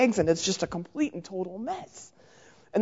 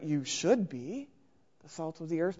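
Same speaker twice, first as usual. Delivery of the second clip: slow, lower nagging "You should be"; slow preachy should; 4 semitones lower, 6 dB quieter than usual. Retold or imitated. Preacher mocking a scolding "should", slow and lower. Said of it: "or my favorite".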